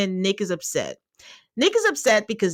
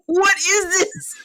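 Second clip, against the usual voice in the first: exasperated wail; 11 semitones higher, 4 dB louder than usual. Retold or imitated. Host recalling pausing the show in agony, exasperated wail. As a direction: plead it high, half-laughing in despair.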